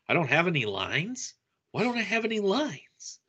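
incredulous, high-pitched protest; Fozzie complaining. Acted. Podcaster voices Fozzie griping about having no lines, plaintive.

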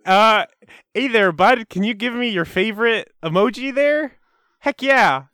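high, loud attempt at a Canadian accent; Cool's character voice. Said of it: "I tried to be Canadian".

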